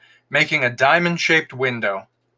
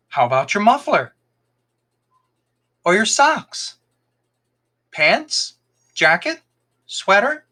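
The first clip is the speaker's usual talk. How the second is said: put-on eager fox boy; 7 semitones higher than usual. A narrator's reading. Fox boy voiced in story.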